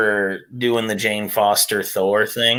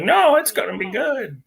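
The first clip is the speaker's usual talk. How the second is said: optimistic fan; 10 semitones higher than usual. Retold or imitated. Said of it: "One of us has to be like".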